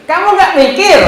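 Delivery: loud, high scolding question; blunt rebuke. Shown as a demonstration. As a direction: scold them outright, no politeness. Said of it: "Bacanya begini".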